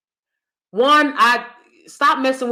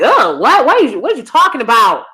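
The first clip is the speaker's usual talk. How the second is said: mock disbelief, louder, fast; incredulous; 3 semitones higher, 6 dB louder than usual. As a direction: scoff at the nonsense. Mock reaction to nonsense.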